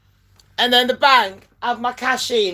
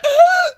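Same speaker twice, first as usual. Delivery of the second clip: high gasp; 4 dB louder than usual. Retold or imitated. Revived from heart attack.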